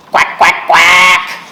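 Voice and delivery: loud playful quacking; duck quacks. Acted. Ducky Duckling telling children her sound, loud quacks. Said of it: "I say".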